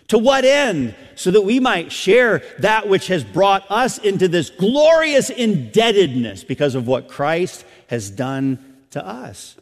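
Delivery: loud, high preacher's voice; impassioned preaching. Real, not acted. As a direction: preach it out at full force. Preaches at full force.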